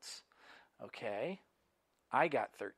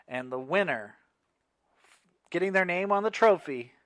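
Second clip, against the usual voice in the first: loud build-up, rising higher; winner announcement; 6 semitones higher, 11 dB louder than usual. Real, not acted. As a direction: announce the winner with a big lift.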